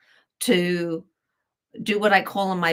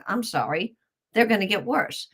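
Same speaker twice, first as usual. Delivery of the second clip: ordinary delivery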